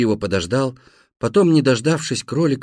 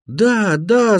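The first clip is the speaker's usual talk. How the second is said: high, loud double yes; high-pitched, emphatic yell; yelled agreement; 8 semitones higher, 4 dB louder than usual. A narrator's reading. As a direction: holler it so a deaf man hears. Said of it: "завопил я".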